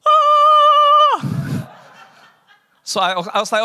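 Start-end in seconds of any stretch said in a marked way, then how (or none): 0.1-1.7 a long scream, far higher and much louder; shrill scream. Retold or imitated. Telling the audience of God's call, long shrill scream. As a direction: let out a long panicked scream. After God says go.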